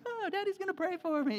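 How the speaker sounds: put-on high, sweet little-girl voice; excited little girl. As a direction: chirp it like an eager child. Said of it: "my younger one's like".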